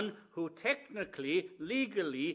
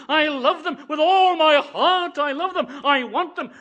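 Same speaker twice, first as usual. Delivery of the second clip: loud, impassioned cry; Jesus pleading; 8 semitones higher, 11 dB louder than usual. Acted. The Lord Jesus answering the law, loud and impassioned. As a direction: plead with all your heart. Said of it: "says the Lord Jesus".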